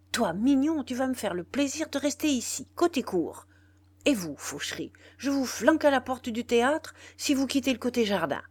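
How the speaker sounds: loud, high angry dressing-down; scolding manager. A narrator's reading. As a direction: scold the two men like a furious boss. (le directeur sermonne les deux hommes, fort et en colère)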